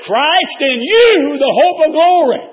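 slow, high, loud preacher's cry; shouted scripture, drawn out; preaching at full force. Acted. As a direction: ring it out to the congregation. Preaching climax.

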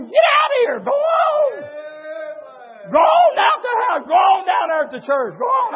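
high shouted dismissal, acted out; hammed-up, high-pitched ordering away; the devil. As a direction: drive them off in a preacher's mock yell. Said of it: "what the devil told you".

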